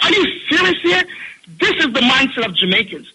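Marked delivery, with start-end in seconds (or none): 0.0-1.0 high, stunned disbelief; can you believe this, pitched up; retold shocked question to the pastor; incredulous. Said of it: "I'm like".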